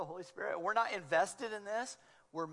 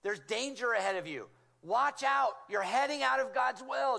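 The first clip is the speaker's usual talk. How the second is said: louder, higher alarm calls; loud alarmed warning; urgent warning; 4 semitones higher, 6 dB louder than usual. Retold or imitated. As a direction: shout a warning to someone about to fall. Spirit warning of danger.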